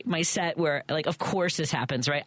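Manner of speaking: ordinary talk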